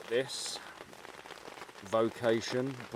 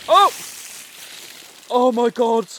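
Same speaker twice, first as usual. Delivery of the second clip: high, loud yelps of shock; shocked shout; 15 dB louder than usual. Real, not acted. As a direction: shriek as cold water hits. Soaked by water off the tarp.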